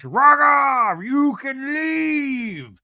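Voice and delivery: very high, shouted housewife impression; over-the-top high yell, drawn out; Meredith Marks. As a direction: wail it, stretching the last word way out. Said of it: "Or Meredith Marks".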